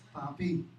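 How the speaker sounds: mock sweet-talk to a father; sweet-talking child. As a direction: coo at daddy to butter him up. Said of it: "le dices".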